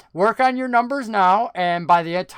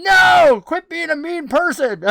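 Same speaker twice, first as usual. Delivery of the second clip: high mock yell from the other room; wife yelling; 10 semitones higher, 7 dB louder, slower than usual. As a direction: shout it like a scolding wife. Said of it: "my wife is yelling".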